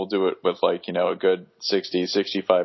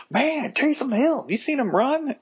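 excited fan. Retold or imitated. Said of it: "it's like".

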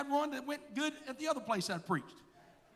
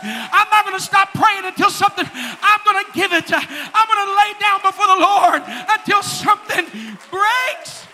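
loud, fervent preaching; 8 semitones higher, 22 dB louder than usual. Acted. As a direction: preach it with full fire. Preaching at full force.

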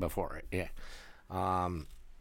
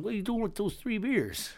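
much higher cop voice; 12 semitones higher than usual. Retold or imitated. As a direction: voice the cop high.

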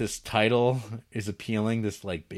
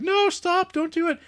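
high, loud panicked plea; mock panic; 6 dB louder than usual. Film characters pleading with him over the gun, mock panic. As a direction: cry out in exaggerated alarm.

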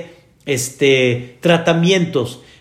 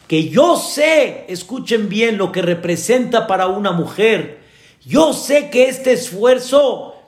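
fervent preaching; 4 semitones higher than usual. Acted. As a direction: press the point home to the congregation. Preacher urges the congregation.

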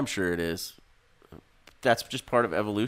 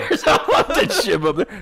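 high, loud, slow laughing awe; mock amazement; 11 semitones higher, 7 dB louder, slower than usual. Friend joining the mockery with wide-eyed laughing awe. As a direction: ham up the wonder.

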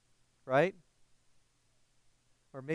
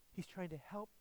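normal talking voice

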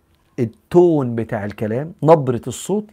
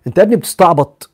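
teasing jab; 5 semitones higher, 8 dB louder than usual. Acted. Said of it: "هضرب لك مثال".

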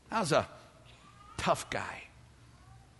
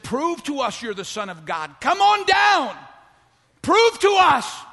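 jeering, much higher and louder, a taunting yell; mocking shout; 12 semitones higher, 12 dB louder than usual. Preacher voicing the thief mocking Jesus, jeering shout. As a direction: hurl the dare up at him in scorn.

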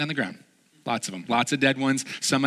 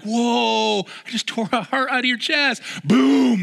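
mock triumph; 7 semitones higher, 4 dB louder, slower than usual. Mock heart grab boast.

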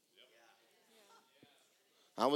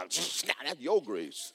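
slow, dry, pointed delivery; pointed retort; 4 semitones lower, 3 dB quieter, much slower than usual. Retold or imitated. Pastor telling the congregation, slow and pointed. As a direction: lean on your, drawn out. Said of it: "I will come out and go".

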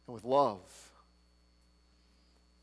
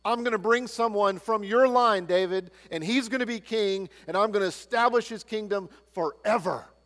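loud, higher proclamation of the promise; God's promise proclaimed; 6 semitones higher, 5 dB louder than usual. Acted. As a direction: declare it like God speaking to David. Preacher voicing God's promise again.

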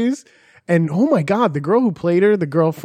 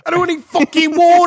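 loud, high-pitched cartoon English accent; shrieky mock-English whine; 7 dB louder, faster than usual. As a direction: protest in a hammed-up English accent. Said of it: "I'm giving her an English accent".

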